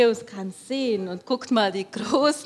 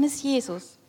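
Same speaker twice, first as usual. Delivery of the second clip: plain reading voice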